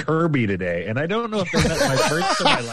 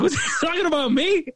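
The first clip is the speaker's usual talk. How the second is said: high disbelieving; 13 semitones higher than usual. Retold or imitated. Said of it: "I was like".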